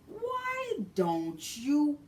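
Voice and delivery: high, slow, drawn-out mock demand; high slow mocking driver voice. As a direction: stretch it out like a stubborn cabbie. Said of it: "So this man's like".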